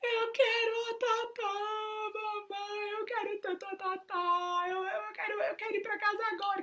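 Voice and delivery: put-on high, sing-song crying kid voice; little daughter whining on the phone for her nanny; crying child. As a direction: wail it like a homesick little girl. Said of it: "Aí ela começou a chorar".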